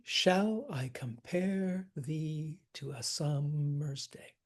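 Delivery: slow line with "Shall" hit hard; trochee scansion. Shown as a demonstration. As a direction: stretch the stressed beats, leading on "Shall". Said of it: "We trochee".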